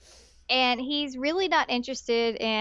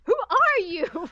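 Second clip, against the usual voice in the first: mock bewildered; 9 semitones higher, 3 dB louder, much slower than usual. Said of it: "I'm like".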